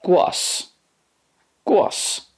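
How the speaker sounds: teacher's "głos" with the ł as w; Polish word, high and light. Shown as a demonstration. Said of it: "and in Polish".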